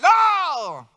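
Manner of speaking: high, loud shrieked no; a demon crying out in protest; demon's scream. Retold or imitated. Demon in the woman refusing loudly, shrieked no. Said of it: "Bota aí no ouvido dele".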